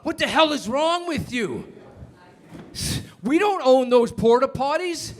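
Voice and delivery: a boss furious about leased porta potties; screaming CEO. Retold or imitated. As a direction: Chevron CEO yelling at the HR head, hammed-up anger. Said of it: "he's screaming at the guy".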